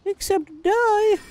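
high, slow, odd creepy tone; high sinister voice. Pretend. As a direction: drag it out like a gleeful villain. Menacing punchline voice.

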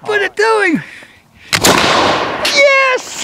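high triumphant yell of yes; excited cheering. Real, not acted. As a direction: celebrate the hit. Cheering the shot.

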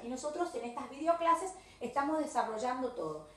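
normal talking voice

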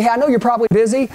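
"I know you're probably busy" rattled off fast; very quick, casual aside; quick reassurance. Shown as a demonstration. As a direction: rush it out before they hang up. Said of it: "This is only gonna take a second".

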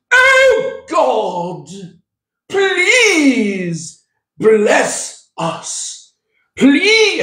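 high, drawn-out British prayer for show; haughty Pharisee praying in a hammed-up British accent; mock British accent. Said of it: "I did a British accent".

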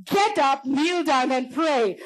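high, forceful command with drawn-out end. Retold or imitated. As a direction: raise the voice, insisting they obey. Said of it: "the louder the voice got".

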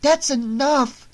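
high, loud and slow, final; furious. Acted. Nancy at the door glaring at her mother, furious. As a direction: bite off each word in rage.

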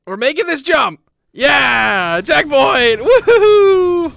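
high, loud excited shouting; triumphant cheering. Real, not acted. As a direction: celebrate building to a woohoo. Celebrating reaching the checkpoint.

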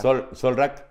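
Solrak, Carlos said backwards; backwards speech. Shown as a demonstration. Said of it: "se llamaba Carlos y era Solca".

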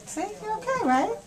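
tender reassurance. Real, not acted. Mom to child after the blood draw, comforting. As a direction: soothe gently now the ordeal is finished.

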